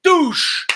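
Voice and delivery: loud, high explosive kick noise; impact sound effect. Retold or imitated. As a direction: voice the smack of a kick landing. Imitates the sidekick landing.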